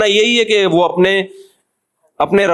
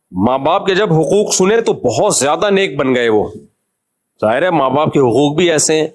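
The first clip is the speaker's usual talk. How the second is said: ordinary talk, nothing marked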